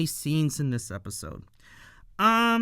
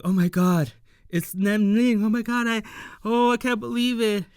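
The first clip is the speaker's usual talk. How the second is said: higher, louder, gushing fan; fan excitement; 6 semitones higher, 4 dB louder, faster than usual. Retold or imitated. Two nerds spotting Lem Ning, excited gushing fan voice. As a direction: gush over the idol, faster at the end. Nerds meeting VTuber idol.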